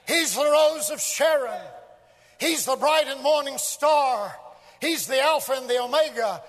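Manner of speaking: high, ringing roll of praise; full-force preaching. Real, not acted. Preacher exalting Christ to his congregation, full-force preaching. Builds a list of titles.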